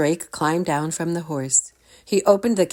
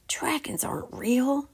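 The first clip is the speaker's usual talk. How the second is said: whispered thought; thinking; 11 semitones higher, 4 dB quieter than usual. A narrator's reading. Drake whispering his thought in disbelief. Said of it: "thought Drake".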